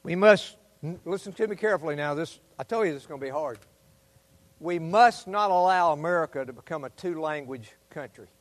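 low, intense, hushed pleading; low fervent voice. Acted. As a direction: lean in and warn them gravely. Urgent low appeal to congregation.

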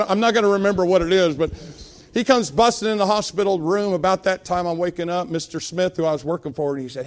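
ordinary delivery

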